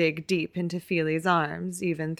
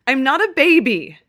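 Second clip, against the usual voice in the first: high, loud furious scream; 8 semitones higher, 13 dB louder than usual. A narrator's reading. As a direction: scream it like a tantrum. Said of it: "Keely kicks and screams and shouts".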